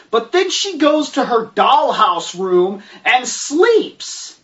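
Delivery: ordinary talk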